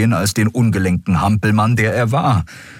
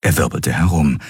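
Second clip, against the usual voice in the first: plain reading voice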